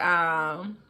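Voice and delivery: ordinary talk, nothing marked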